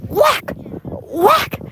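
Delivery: high, loud whack sound effect; whack, whack, a playful mock blow; imitated smack. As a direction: smack the word out like a slap. Acting out a smack.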